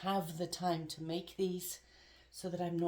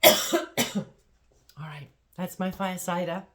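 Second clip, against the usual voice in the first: coughing up water; 11 dB louder than usual. Real, not acted. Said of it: "You can hear it's clearing my voice".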